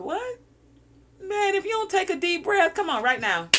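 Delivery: high, loud startled push; surprised urging. Pretend. Coaxing a shallow-breathing listener, startled and loud. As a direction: jolt them into breathing.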